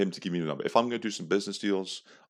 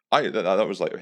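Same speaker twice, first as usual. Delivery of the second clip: normal talking voice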